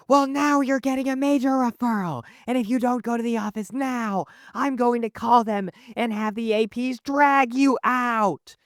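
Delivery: high, screechy teacher impression; mock-furious threat from a cornered teacher; desperate angry teacher. Teacher threatening a major referral, hammed-up shrill fury. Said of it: "it makes them desperate".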